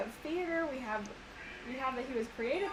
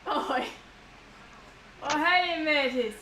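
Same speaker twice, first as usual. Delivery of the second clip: put-on British pirate accent; 4 semitones higher, 7 dB louder than usual. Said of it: "Sound like a British pirate".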